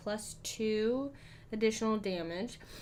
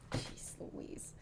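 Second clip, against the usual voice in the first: breathy whispered Jeez Louise under the breath; whispered aside; 12 dB quieter than usual. Real, not acted. Dismayed gamer whispering at her bad roll. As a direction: mutter it to yourself, voiceless.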